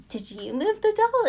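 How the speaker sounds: asking her friend about the moved doll, alarmed; alarmed question. Retold or imitated. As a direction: ask it in a startled rush.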